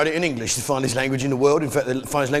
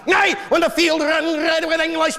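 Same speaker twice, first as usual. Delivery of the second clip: high, strained Scottish battle cry; mock Scottish rant; 12 semitones higher than usual. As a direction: bellow it like a hammed-up Scottish patriot. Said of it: "you Jocks, you like to say".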